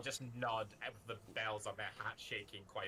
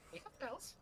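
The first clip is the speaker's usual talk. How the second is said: high creature voice; 5 dB quieter than usual. Acted. Creature voice offering bells.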